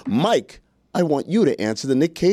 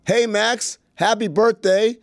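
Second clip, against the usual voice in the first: upbeat, higher video greeting; cheerful birthday shoutout; Cameo host voice; 6 semitones higher, slower than usual. Pretend. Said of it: "take two, take two".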